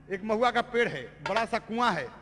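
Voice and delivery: usual speaking voice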